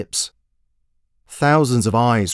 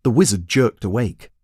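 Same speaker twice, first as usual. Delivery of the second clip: plain reading voice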